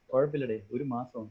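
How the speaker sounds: soft, low, casual dismissal; easygoing. Acted. Second employee shrugging off the one-month deadline, easygoing. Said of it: "This is the way they say it".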